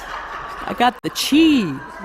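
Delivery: half-speed, drawn-out catchphrase imitation; Sally. Friend acting out Sally's catchphrase, slow and drawn out. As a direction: linger on "chi" with a big swooping stretch. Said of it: "with the eyes and the hands".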